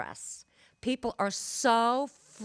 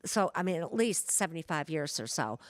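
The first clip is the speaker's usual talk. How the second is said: ordinary talk, nothing marked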